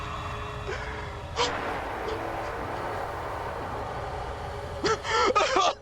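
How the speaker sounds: whispered panting gasp; long breathless gasp. Real, not acted. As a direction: struggle to catch your breath. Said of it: "I can't breathe".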